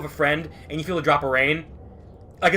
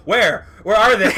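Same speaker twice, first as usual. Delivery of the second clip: louder, higher mock panic; imitating his friend searching in panic, louder and higher; frantic asking; 6 semitones higher, 9 dB louder than usual. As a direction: demand where they are, getting louder. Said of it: "and you're like".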